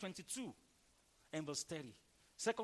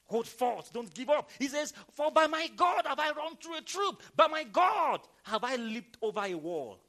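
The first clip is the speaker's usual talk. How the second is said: loud, urgent exhortation; full-force preaching; 5 semitones higher, 9 dB louder than usual. Real, not acted. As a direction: drive the verse home at high volume.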